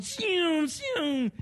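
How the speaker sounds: car noise imitation. Imitating cars passing.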